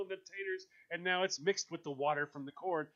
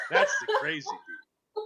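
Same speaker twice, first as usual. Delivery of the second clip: much higher, louder, laughing through it; laughing punchline; 8 dB louder than usual. Real, not acted. Host laughing through his own punchline. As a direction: crack up on the punchline.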